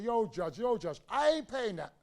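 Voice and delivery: put-on brash kid talking back; a touch of a cheeky shout; defiant. Defiant young man answering judge.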